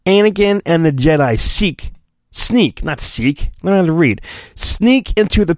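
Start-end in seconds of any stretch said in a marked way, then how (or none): none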